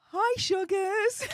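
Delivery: high-pitched, drawn-out mock-sweet hello; cheeky high greeting. Streamer taunts those who tried to stop her, cheekily high-pitched. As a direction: sing out a teasing greeting way up high.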